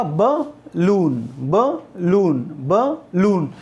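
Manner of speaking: "Balloon" split as ब, pause, लून; short ब, gap, then long लून in "balloon"; syllable by syllable. Shown as a demonstration. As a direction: repeat "balloon" in two separate beats. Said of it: "एक तो हो गया ब".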